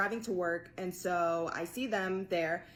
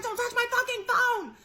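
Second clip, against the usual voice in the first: much higher and louder mock yell with a laugh; retold yell; 7 dB louder than usual. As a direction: shout them off your phone. Yelling at scammers.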